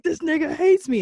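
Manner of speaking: high, loud imitated panic; mock paranoid panic. Imitating her overreaction to a text, high and loud. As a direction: pitch it up as if panicking. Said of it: "instead of being like".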